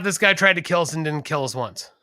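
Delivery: ordinary talk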